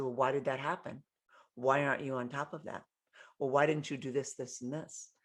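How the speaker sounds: soft, low, measured questioning; quietly composed reprimand; calm even voice. Retold or imitated. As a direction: stay calm while mad. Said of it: "he's just calm".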